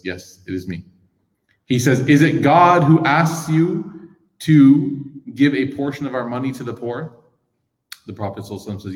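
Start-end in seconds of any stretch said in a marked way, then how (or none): 0.0-0.8 quiet gentle yes, it is me; hushed humble reply; soft humble answer. Retold or imitated. 2.1-7.2 slow insistent question. Retold or imitated. Bedouin question.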